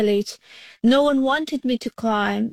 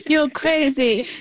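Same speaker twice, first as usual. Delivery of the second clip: laughing scold; 3 semitones higher than usual. Retold or imitated. Imitates dad's disbelief, chuckling.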